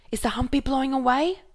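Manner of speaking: high, loud and fast alarmed question; alarmed cry. Acted. Stormboy asks his father over the storm, high alarmed cry. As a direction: blurt it out in alarm.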